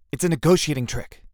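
ordinary delivery

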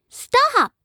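put-on high, loud little-girl shout; child yelling stop. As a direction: stop them cold, like a fierce kid. Girl blocks her parents.

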